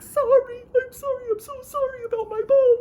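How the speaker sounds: very high, fast, wailing apology; apologetic little boy. Acted. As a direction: squeal it as a panicked child. Boy apologises after ball hits people.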